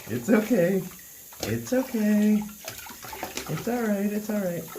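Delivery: calm the little pet down sweetly. Real, not acted. Soothing a squirming guinea pig.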